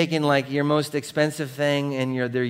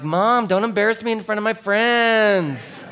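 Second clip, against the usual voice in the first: put-on embarrassed teen; 9 semitones higher, 4 dB louder than usual. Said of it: "I'd be like".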